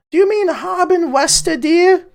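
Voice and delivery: put-on high old lady voice calling from the back; old woman. Innkeeper's wife calls from the back room, high old-woman voice. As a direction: pitch way up like a sweet elderly wife. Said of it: "I don't know why she's so old".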